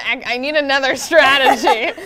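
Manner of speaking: laughing through the words; breaking into laughter. Real, not acted. Laughing at own story.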